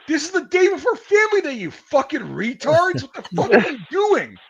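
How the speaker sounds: high-pitched, loud imitated tirade; outraged platoon guy telling them off; exasperated scolding. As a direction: chew out the squad in disbelief.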